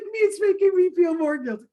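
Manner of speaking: high, whiny mock echo of the line. Wife echoing husband's joke in a high whiny mock voice. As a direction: toss it back in a squeaky teasing voice. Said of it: "I'm like".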